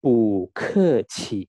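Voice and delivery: "bu ke qi" slow, loud, with puffed k and q; Mandarin model. Shown as a demonstration. Teacher sums up how to read it, slow loud model. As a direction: draw out "bu ke qi" loudly with bursts of air. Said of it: "Cara membacanya".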